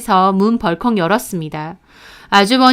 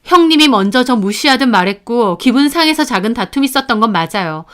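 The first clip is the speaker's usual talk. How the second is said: ordinary delivery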